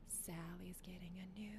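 soft unvoiced whisper. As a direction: whisper the line like a secret. Singing a remembered show line.

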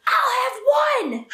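high, slow, drawn-out shout; mock child's screamed ultimatum; screamed demand. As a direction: imitate a furious small child laying down the law. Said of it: "Like, screams it".

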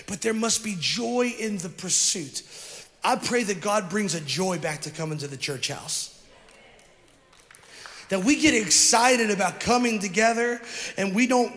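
ordinary talk

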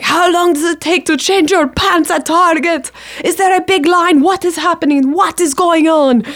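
high, exasperated mock nagging; frantic agent. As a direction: act out an impatient agent demanding answers. Agent's frantic texts acted out.

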